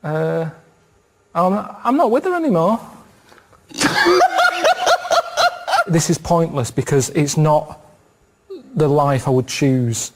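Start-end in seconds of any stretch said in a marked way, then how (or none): 3.7-5.8 high burst of laughter. Real, not acted. Laughing at his own answer, a high burst.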